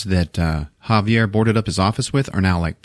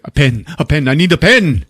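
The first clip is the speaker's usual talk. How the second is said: raised, increasingly urgent demand; mock urgency; 6 semitones higher, 4 dB louder than usual. Inspired writer grabbing paper, mock urgent. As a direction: build louder and higher with mock inspiration.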